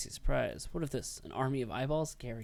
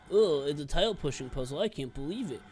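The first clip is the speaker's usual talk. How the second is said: loud, higher scoffing imitation; mocking whiny voice; 6 semitones higher, 6 dB louder than usual. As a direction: mimic the complainers, dismissive. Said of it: "as some people say".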